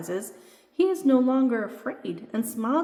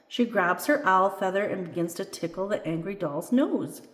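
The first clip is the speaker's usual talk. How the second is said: ordinary delivery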